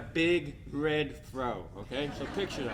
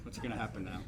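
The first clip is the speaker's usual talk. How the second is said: inner panic; 8 semitones lower, 10 dB quieter than usual. Retold or imitated. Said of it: "I'm saying".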